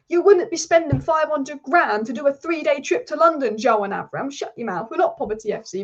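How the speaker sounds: usual speaking voice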